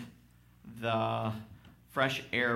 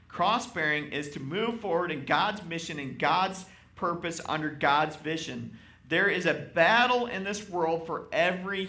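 rising, louder preacher's call; full-force preaching; 6 semitones higher, 6 dB louder than usual. Acted. Pastor urging the congregation, full-force preaching. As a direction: preach it out to the pews.

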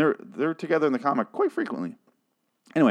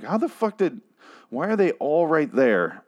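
high, incredulous outburst; mock disbelief at the show, pitched up; exasperated; 5 semitones higher than usual. Viewer ranting at the show, exasperated high disbelief. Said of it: "and I'm like".

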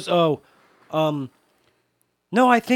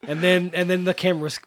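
ordinary talk

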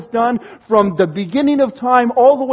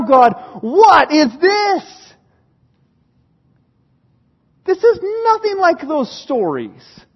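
slow, raised cry of disbelief; bewildered protest; 4 semitones higher, slower than usual. Acted. Psalmist questions God in defeat, bewildered protest. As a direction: plead for an explanation, slowly.

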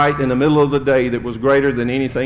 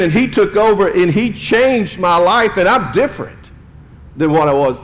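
higher, louder, building fervent testimony; believer's testimony; 5 semitones higher, 4 dB louder than usual. Retold or imitated. Preacher voicing a believer to somebody, higher and louder testimony. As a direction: testify like a changed believer, pitch climbing. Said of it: "you can go to somebody and say".

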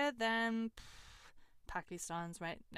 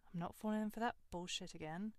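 ordinary talk, nothing marked

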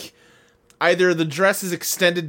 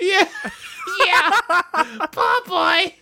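put-on tiny British baby voice; 12 semitones higher, much slower than usual. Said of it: "tiny baby voice British".

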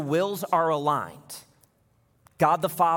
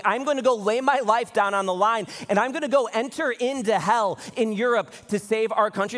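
impassioned resolve; 8 semitones higher, 4 dB louder than usual. Retold or imitated. Voicing soldiers' resolve, impassioned.

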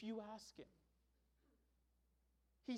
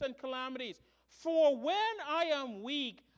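raised, emphatic sermon delivery; forceful preaching; 5 semitones higher, 8 dB louder than usual. Acted. Pastor closes his sermon on strength in weakness, preaching forcefully. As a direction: drive the point home from the pulpit, loud.

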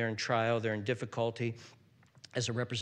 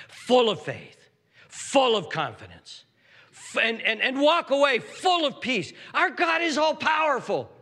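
loud, raised pulpit fervour; fiery preaching; 11 semitones higher, 10 dB louder than usual. Acted. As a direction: drive it home to the congregation. Builds the sermon to its peak.

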